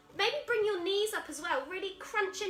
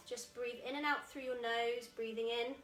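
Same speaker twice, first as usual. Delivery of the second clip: guided relaxation; 3 semitones lower, 5 dB quieter than usual. Acted. Teacher leading seated breathing, soft and calm. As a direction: ease the children into stillness.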